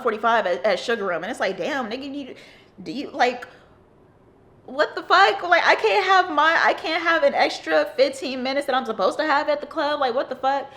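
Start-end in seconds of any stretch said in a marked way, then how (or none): 1.6-10.7 much higher and louder, laughing through the complaint; exaggerated disbelief, squeaking up on what the fuck; playful indignant rant; mock outrage. Mock outrage at early lights.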